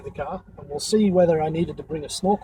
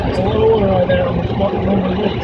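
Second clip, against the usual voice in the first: loud over the car wash; raised over noise; 3 semitones higher, 5 dB louder than usual. Real, not acted. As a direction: speak up over the roaring jets. Water blasting the car roof.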